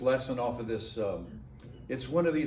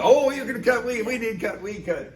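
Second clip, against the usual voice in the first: higher, louder delighted burst; eager pastor; 9 semitones higher, 6 dB louder than usual. Retold or imitated. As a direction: grab the newcomer, thrilled.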